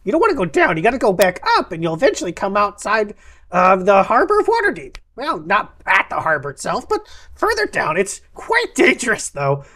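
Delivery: animated shopkeeper with high-pitched leaps; excitable map seller. Acted. Map seller advising travellers on the way out, excitable. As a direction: voice a lively merchant, swooping up high.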